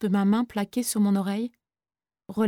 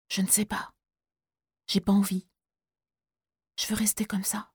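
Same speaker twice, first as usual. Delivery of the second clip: very soft, almost breathless whisper; exhausted boy answering in a whisper; faint whisper; 7 dB quieter than usual. Acted. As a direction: barely get the words out.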